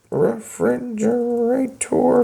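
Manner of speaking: slow, drawn-out, writing refrigerator letter by letter; slow spelled out. Shown as a demonstration. Teacher writing refrigerator into the contract, slow and drawn out. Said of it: "Is that how you spell it?".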